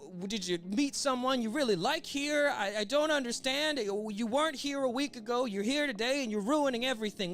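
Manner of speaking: high-pitched, desperate mock pleading; hammed-up villain begging in a high voice. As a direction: plead for any explanation, stammering. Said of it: "he is just begging Luffy to give him".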